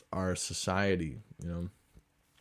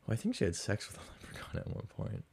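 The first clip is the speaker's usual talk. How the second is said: whispered aside; 10 dB quieter, faster than usual. Real, not acted. Gossipy aside.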